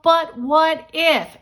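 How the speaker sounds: "But what if?" drawn out slowly, high and bright; hopeful what if. Shown as a demonstration. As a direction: make "But what if?" sound like an exciting possibility. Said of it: "And say it that way".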